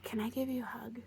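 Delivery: hushed, gentle question; soft whisper. Retold or imitated. The healer offering a hug, whispered.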